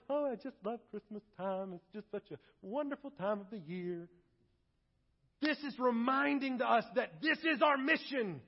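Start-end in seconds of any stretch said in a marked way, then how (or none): 0.1-4.1 mock cozy. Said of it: "looking at Christmas lights".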